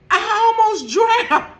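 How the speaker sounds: high yelled retelling of the sister; imitating the sister's cry, loud and high; mock shout. Sister shouting she almost drowned, loud and high. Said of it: "she's like".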